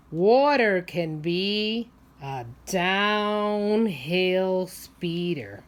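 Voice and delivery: drawn out, slow storybook line; reading aloud to children. Acted. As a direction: stretch the words out for the little ones. Reads book line, slowed.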